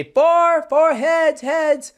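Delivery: high, loud warning call across the course. Retold or imitated. Host reenacting warning people about a stray golf ball, calling out loud.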